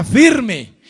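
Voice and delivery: a firm rallying call; loud call to repeat. Shown as a demonstration. Preacher leads the congregation in a loud firm call. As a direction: shout it out strong and falling.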